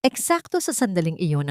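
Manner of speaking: usual speaking voice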